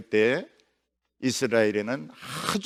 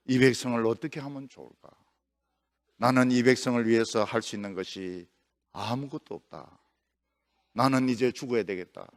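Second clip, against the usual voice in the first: despairing sigh; 7 semitones lower, 7 dB quieter than usual. Acted. 절망한 엘리야의 독백.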